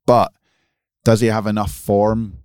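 ordinary talk

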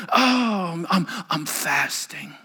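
faint, slow, hammed-up suffering; mock weary. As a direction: sigh it out like a martyr. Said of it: "I come into work on Fridays and I go".

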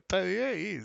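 quietly repeating the price under his breath; in a low voice. Retold or imitated. Friend at night repeating the price, quietly. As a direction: murmur the quote softly so only he hears. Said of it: "me dice él en voz baja".

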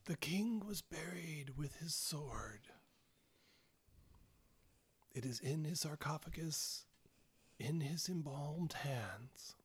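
slow, hushed whisper from the grave; eerie, drawn-out undead whisper; ghastly whisper. Acted. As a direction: answer like a long-dead corpse, whispering. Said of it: "this kind of ghastly whisper from the grave".

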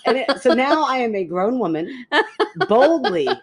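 high, giggly storytelling through laughter; can barely get it out for laughing; laughing while telling. Real, not acted. Telling her friend the gas-station story, laughing throughout.

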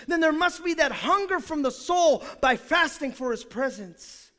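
high, loud, shouting sermon voice; full-force preaching. Acted. Pastor crying out to the congregation about the presence of God, full force. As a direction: preach it to the rafters.

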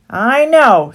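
sly stallholder. A narrator's reading. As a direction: sell it to them. Rat's idea.

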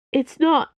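high, loud awkward denial. A narrator's reading. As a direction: blurt it out awkwardly. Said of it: "Dana said awkwardly".

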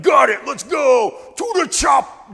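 high, loud, slow bellowed command; shouted order. Acted. Soldier yells to comrades to run, loud high shout. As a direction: bark it to your men over the fighting.

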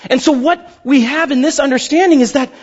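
usual speaking voice

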